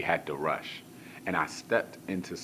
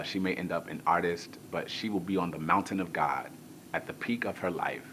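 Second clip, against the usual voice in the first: ordinary delivery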